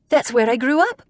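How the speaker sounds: Lorna's Irish lilt, pleased; Irish accent. A narrator's reading. Lorna tells the sailor she grew up there, Irish accent. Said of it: "He likes her accent".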